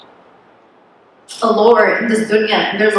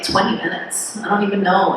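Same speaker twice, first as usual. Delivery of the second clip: usual speaking voice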